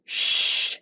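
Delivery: sustained airy shush; unvoiced sh. Shown as a demonstration. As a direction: push out a steady sh with no voice. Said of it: "If people can try for a second".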